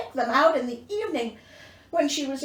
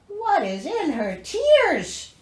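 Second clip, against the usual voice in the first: mock amazement; 6 semitones higher, 5 dB louder, slower than usual. Real, not acted.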